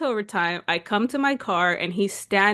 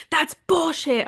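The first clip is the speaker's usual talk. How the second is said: higher, indignant dad retort; mock outrage, pitched up; raging dad; 8 semitones higher, slower than usual. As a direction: imitate a father angrily dismissing an excuse. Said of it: "My dad calls me raging".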